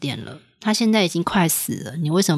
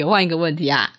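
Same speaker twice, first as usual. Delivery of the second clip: normal talking voice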